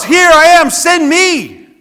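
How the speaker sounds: high, loud eager yell. Retold or imitated. Preacher voicing Isaiah answering God's call, yelling. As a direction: cry it out at full volume. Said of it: "he yells".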